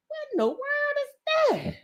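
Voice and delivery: high, soft, drawn-out disbelief; retold astonishment. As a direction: re-enact your shock, slowly and high. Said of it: "and I was like".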